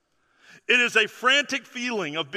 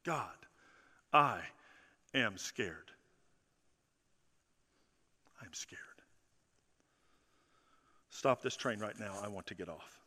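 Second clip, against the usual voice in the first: very soft, small voice, near a whisper; barely voiced plea to God; frightened hushed prayer; 10 semitones lower, 12 dB quieter than usual. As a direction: confess it quietly like a scared man praying. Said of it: "This is what he's saying".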